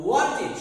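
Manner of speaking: ওয়ান্টেড said "wan-tid"; short i in the last syllable of ওয়ান্টেড; correct -id ending. Shown as a demonstration. Teacher models the correct word for viewers, ওয়ান্টেড ending in -tid. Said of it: "সঠিক উচ্চারণ হবে".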